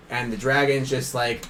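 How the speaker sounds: normal talking voice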